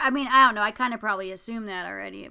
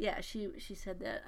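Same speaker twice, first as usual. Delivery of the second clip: yawning; 2 semitones lower, 11 dB quieter than usual. Real, not acted. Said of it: "I'm sorry, I'm yawning".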